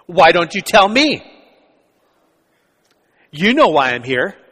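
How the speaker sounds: loud, confrontational challenge; abrasive. Retold or imitated. Fernando snaps back at the pastor, loud and abrasive. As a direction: throw it back at him defiantly. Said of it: "And quite abrasively".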